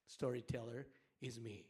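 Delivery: ordinary talk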